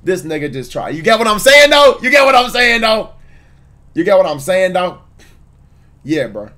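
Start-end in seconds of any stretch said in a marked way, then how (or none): 0.9-5.0 pushing higher and louder, demanding agreement; insistent repeat. Real, not acted. Streamer hammering chat for agreement, insistent and high. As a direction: keep hammering the question at chat.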